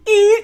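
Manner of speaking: high, loud brakes-screech "eh"; screeching stop noise. Retold or imitated. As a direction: screech to a halt with the voice. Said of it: "that screeching stop".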